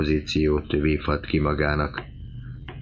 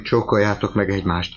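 usual speaking voice

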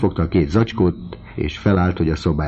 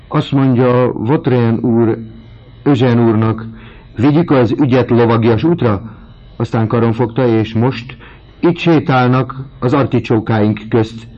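high, loud breathless gossip; gossipy cook voice; 6 semitones higher, 6 dB louder than usual. A narrator's reading. Sylvie the cook breathlessly telling the news. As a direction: spill the gossip in a put-on servant voice.

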